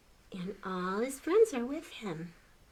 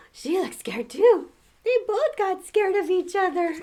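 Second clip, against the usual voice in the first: much higher and louder, wide-eyed; excited gasp; 10 semitones higher, 8 dB louder than usual. Real, not acted. Showing the children the picture, excited exclaim.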